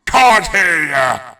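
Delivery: slow, very loud; booming butcher. Acted. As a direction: boom at him. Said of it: "boomed the butcher".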